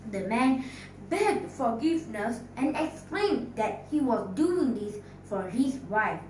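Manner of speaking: ordinary delivery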